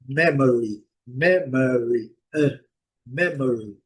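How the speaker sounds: memory stressed on MEM, the rest reduced; stress on first syllable. Shown as a demonstration. Teacher contrasting English memory with Portuguese memória. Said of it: "a acentuação tônica é na primeira sílaba".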